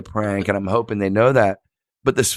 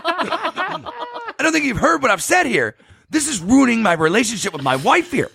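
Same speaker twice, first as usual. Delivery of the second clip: angry husband; 12 semitones higher than usual. Retold or imitated. Husband scolding the girlfriend on the phone. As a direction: play the husband losing patience. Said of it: "He goes".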